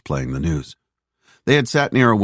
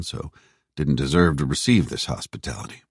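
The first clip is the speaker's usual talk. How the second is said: ordinary delivery, nothing marked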